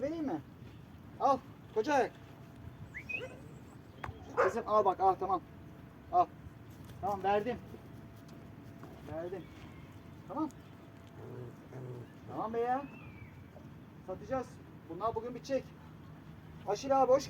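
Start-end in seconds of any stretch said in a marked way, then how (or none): none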